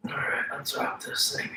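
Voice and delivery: whispered aside; hushed off-mic whisper. Real, not acted. Aside before recording starts.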